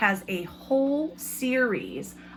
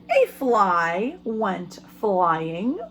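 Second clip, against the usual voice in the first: slow, lively storybook reading; reading to children. A narrator's reading. Teacher reading a picture book to her class, lively storytelling.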